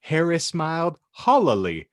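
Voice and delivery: normal talking voice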